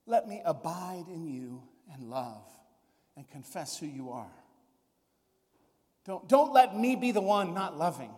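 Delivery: a lightly voiced, earnest plea; humble prayer. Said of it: "I wanna say".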